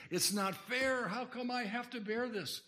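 loud, high-pitched mock whine of protest; protesting complaint. Preacher acting out a burdened believer complaining to God, loud protest. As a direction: cry out against the unfairness, hammed-up.

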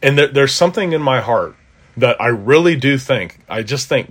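usual speaking voice

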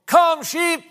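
high, loud copy of a shepherd's call; mimicking yell. Dad copying the pastor's sheep call, yelled high and loud. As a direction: belt it out, imitating the pastor syllable for syllable. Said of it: "With every mimicking syllable I could muster".

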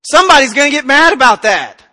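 agitated protest. Retold or imitated. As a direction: act out balking at a hard task. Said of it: "all week getting hammered on it back here going".

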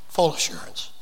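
unvoiced whisper of the phrase; soft whispered close. Shown as a demonstration. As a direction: breathe it out like a secret.

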